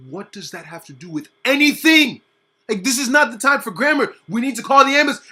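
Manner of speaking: loud exasperated outburst; exasperated yell. Retold or imitated. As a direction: shout it in frustration. Kid yelling at teacher.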